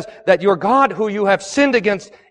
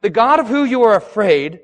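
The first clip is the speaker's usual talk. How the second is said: ordinary delivery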